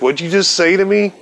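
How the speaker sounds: high, incredulous what'd you say; a touch of outraged disbelief; indignant. Retold or imitated. Offended retort to slur.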